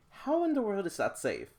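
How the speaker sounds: high, loud astonished question; shocked disbelief. Real, not acted. Vlogger reacting to a dangerous invention, shocked. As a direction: gasp at how unsafe it is.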